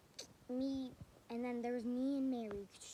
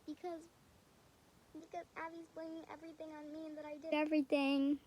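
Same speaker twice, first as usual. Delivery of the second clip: whiny, teary retelling of her own answer, higher and louder; retold crying plea; 6 semitones higher, 7 dB louder than usual. To the teacher, whining through retold tears. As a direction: plead through mock tears as if still upset.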